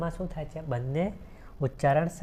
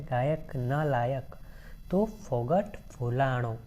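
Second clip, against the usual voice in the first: each word of the verse drawn out slowly; slow recitation; slower than usual. Shown as a demonstration. Teacher repeating the verse line slowly for the class, slow recitation. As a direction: read it slowly so the class hears the sounds. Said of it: "શું કહે છે?".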